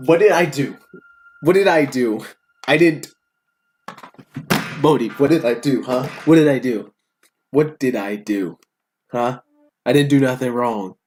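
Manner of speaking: mock indignant protest. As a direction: protest your innocence, hammed-up. Reader protesting being blamed.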